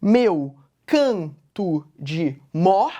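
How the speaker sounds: "Meu canto de mor" slow, stressing "can" and "mor"; "Meu canto de mor" in separate beats, strong on "can" and "mor"; weak-strong scansion. Shown as a demonstration. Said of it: "se isso se mantém ainda".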